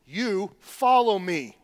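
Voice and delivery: slow, weighty "You follow me"; firm pastor's imperative; stern command. Shown as a demonstration. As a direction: lay down the command slowly. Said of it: "being very stern here with Peter".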